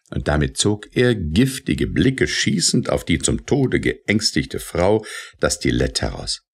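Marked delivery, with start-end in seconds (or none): none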